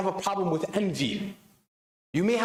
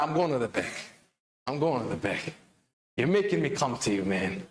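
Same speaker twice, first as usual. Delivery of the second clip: normal talking voice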